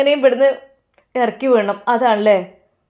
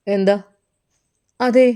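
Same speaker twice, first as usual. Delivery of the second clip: normal talking voice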